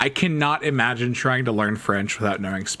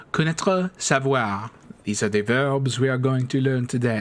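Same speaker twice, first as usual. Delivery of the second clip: put-on French-accented teacher voice; French professor impression. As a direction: announce the lesson with a mock French accent. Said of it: "I don't know why I'm talking like that".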